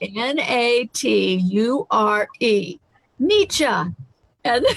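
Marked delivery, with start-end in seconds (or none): 0.0-3.6 spelling it out slowly. Retold or imitated. Australian guest patiently spells out nature, slow. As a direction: spell each letter slowly, then Nietzsche. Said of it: "And she goes".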